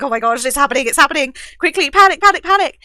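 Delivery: playful panicking, rising in pitch and volume; excited mock panic. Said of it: "I was so excited".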